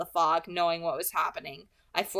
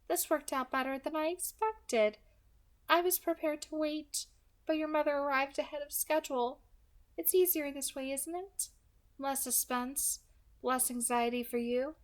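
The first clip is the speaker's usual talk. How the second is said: light, higher, smooth villain voice; 7 semitones higher than usual. Acted. Kidnapper calmly threatening the girl on the phone, pleasant and light. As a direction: menace wrapped in a pleasant voice.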